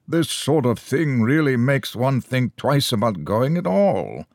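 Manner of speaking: high, pointed remark meant to be overheard; unnecessarily loud. A narrator's reading. Scowling short man complaining about the narrator to a neighbour, loudly. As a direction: say it loud enough for the stranger to hear. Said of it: "rather unnecessarily loudly".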